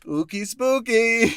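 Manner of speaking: high, loud, slow spooky singsong. Pretend. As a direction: spook them in a hammy ghost voice. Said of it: "Oogie spooky concession impression".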